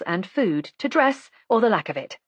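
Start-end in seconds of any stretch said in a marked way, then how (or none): none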